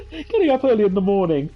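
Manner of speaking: high voice with a teary laugh; emotional. Real, not acted. Streamer recalling late nights of childhood gaming, choked up. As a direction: apologise through welling emotion, pitched high. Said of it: "I got really emotional".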